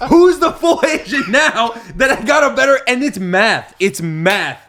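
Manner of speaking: very high, loud crowing shout; triumphant taunt. Retold or imitated. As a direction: rub the win in at full volume. Retold gloat over beating classmates.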